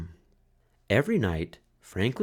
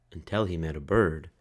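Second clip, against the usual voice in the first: usual speaking voice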